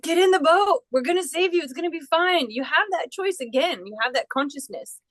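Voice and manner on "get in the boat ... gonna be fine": higher, pleading rescuer voice; rescuers urging. Retold or imitated. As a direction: coax the stubborn one aboard. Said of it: "they're like".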